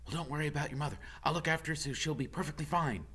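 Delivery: high, eager squirrel chatter; squeaky excited squirrel voice; chatty squirrel. Acted. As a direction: pester him like a nosy little squirrel. Squirrel reassures quickly.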